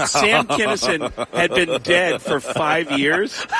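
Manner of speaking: laughed through, slow and rising; laughing. Real, not acted. Comedian laughing about the dead Kinison prank. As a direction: crack up while explaining.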